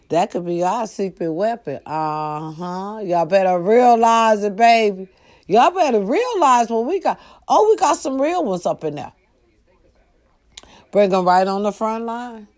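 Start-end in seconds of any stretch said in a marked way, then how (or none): 3.0-12.5 rising heated call-out; raised voice. Real, not acted. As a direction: pitch climbing, voice pushed louder. Heated rant to America.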